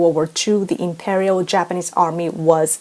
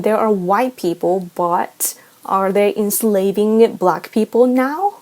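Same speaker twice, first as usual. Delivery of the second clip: normal talking voice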